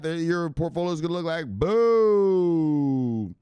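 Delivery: slow, drawn-out build to a loud "boom"; hype-man payoff; hyped boom. Real, not acted. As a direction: stretch it out and land the boom. Hyping portfolio gains.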